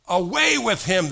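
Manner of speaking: loud, high jeering cry; mock crowd yell. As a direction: shout it like an angry mob. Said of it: "they yelled".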